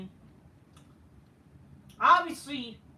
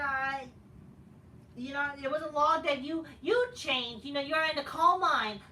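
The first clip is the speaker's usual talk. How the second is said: ordinary delivery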